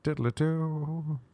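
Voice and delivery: slow, high, soft sung flute tune; mock flute tune. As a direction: croon it slowly like a flute. Imitating flute playing.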